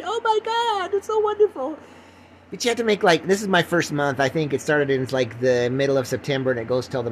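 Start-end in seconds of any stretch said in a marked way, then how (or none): 0.0-1.8 very high put-on gushing; hammed-up falsetto rapture; mock gushing listener. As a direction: squeal with exaggerated admiration. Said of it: "I know what you're thinking".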